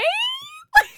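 sudden high, loud laugh; real amused laugh; high burst of laughter. Real, not acted. Laughs at the Biden DMs.

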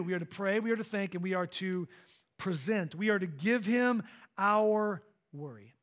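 ordinary delivery

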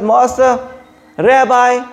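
pleading cry to Jesus, pitched high. Acted. Woman begging Jesus for her daughter, high pleading cry. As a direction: cry out to the Master for help. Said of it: "she cries out".

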